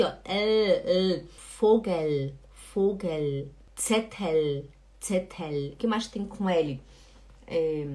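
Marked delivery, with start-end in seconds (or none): 0.2-5.7 Vogel and Zettel lower, each ending on a held L; tongue tip stays up on the L of Vogel, Zettel; correct final L. Shown as a demonstration. Teacher showing students how it must sound, clear L. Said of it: "Você tem que falar aqui".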